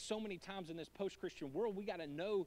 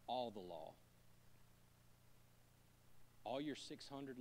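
solemn quiet preaching; 8 semitones lower, 11 dB quieter than usual. Real, not acted. Preacher to the church, hushed and solemn. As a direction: hush the room for the key line.

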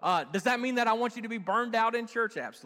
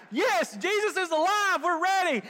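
high, loud joyful cry; excited cheer; 11 semitones higher, 6 dB louder than usual. Retold or imitated. Preacher voicing an excited believer, loud joyful cheer. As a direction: cheer like you're fired up. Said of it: "you're like".

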